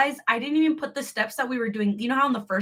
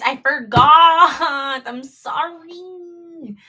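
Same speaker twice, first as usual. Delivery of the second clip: mock wail; 4 semitones higher, 7 dB louder, much slower than usual. Mock wail apologising to chat.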